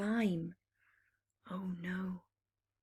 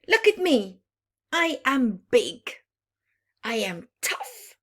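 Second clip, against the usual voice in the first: a hint of a growly little wolf, loud at first; growling wolf pup; 4 semitones higher, 7 dB louder than usual. Young wolf boasting to the pack, a light mock growl. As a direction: puff up like a small tough pup. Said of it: "he would growl".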